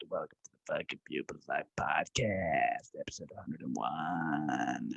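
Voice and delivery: low soft whisper, dropping further; deep hushed welcome. Acted. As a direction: whisper the welcome and episode number. Whispered intro again.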